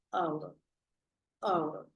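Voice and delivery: owl said slowly, the h silent, opening on the vowel; pronunciation demo. Shown as a demonstration. Teacher showing students how owl sounds. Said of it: "cuando tú pronuncias, tú dices".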